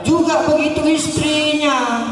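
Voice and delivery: high, slow preaching call; preaching shout. Real, not acted. Preacher exhorting wives to obey. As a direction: drive the point home.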